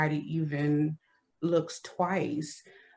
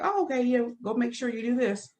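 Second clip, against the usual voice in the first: breezy friendly cop; 7 semitones higher, faster than usual. Retold or imitated. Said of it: "it was just like".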